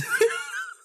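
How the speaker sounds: high squealing burst of laughter; shrieking laugh. Real, not acted. Laughing at the caller's verdict, high shriek of laughter. Shocked laugh after the remark.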